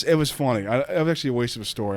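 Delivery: usual speaking voice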